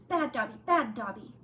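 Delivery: loud self-scolding shout. A narrator's reading. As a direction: bark it at yourself.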